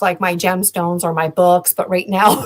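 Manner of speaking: normal talking voice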